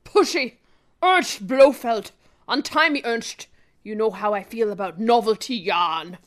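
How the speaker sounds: slow, stern captive agent; mock-grave spy with a hint of German; spy, drifting German. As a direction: order your captor slowly, sinking low. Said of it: "that's kind of German, isn't it?".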